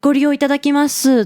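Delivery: a light put-on service voice for the set phrase; formal clerk Japanese. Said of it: "the very formal Japanese".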